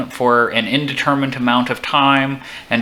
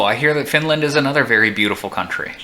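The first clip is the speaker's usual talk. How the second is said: ordinary delivery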